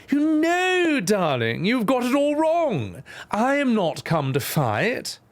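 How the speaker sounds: patronizing queen. Acted. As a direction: coo down at them slowly. Queen corrects hero, patronizing.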